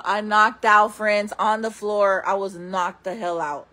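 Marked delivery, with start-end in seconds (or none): none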